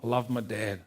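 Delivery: soft, quiet plea to God with a sigh; humble prayer. Retold or imitated. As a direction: pray simply for dad. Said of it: "It was just".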